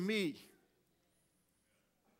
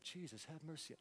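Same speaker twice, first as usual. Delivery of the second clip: very soft, low, offhand reading; murmured, as if idly reading scripture; read without urgency; 5 semitones lower, 18 dB quieter than usual. Retold or imitated. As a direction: toss the plea away under your breath. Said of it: "we kinda read it like".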